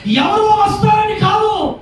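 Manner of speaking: high, slow, drawn-out shout; impassioned preaching shout. Real, not acted. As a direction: stretch it out in a preacher's cry. Preacher challenging congregation. (సాగదీస్తూ గొంతెత్తిన బోధ)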